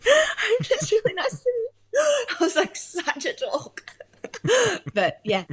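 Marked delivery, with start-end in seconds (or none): 0.1-1.7 very high, giggly mock-whimper; starstruck panic. Filmmaker re-enacting meeting the star, sweating, starstruck panic. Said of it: "like, sweating all over". 2.0-4.9 high, squeaky words through real laughter; embarrassed laughter. Real, not acted. Filmmaker admitting being totally uncool, embarrassed laughter. Laughing at own starstruck behaviour.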